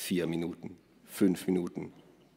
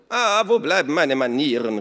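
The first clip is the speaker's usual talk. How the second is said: loud, high hostess call; 7 semitones higher, 8 dB louder than usual. Acted. Minna to her hungry guests, calling out loud. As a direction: call it out heartily. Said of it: "rief Minna".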